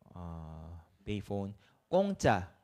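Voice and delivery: ordinary talk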